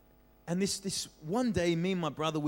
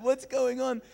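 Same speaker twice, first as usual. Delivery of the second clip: panicked question; 9 semitones higher, slower than usual. Retold or imitated. Crying kid, confused.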